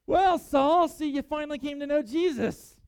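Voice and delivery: high, loud smug I-told-you-so; condemning, judgmental. Pretend. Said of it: "that condemning, judgmental attitude".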